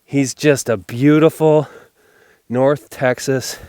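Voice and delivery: loud, delighted marveling; amazed. Real, not acted. Hunter marveling at the wide buck, loud and delighted. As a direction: gush over the buck. Admiring the buck he just shot.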